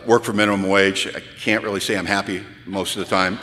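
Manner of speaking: low, halting, glum monotone; mumbly, unimpressive newcomer testimony; sheepish six-month pitch. Retold or imitated. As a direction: deliver a hopeless sales pitch, hesitant and low. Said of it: "which was kinda like".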